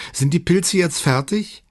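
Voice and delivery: put-on high, impatient child's question. A narrator's reading. Daughter asks her mother about the mushrooms, impatient. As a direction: ask impatiently, pitched well up. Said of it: "ihre ungeduldige Tochter".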